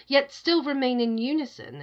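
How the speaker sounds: plain reading voice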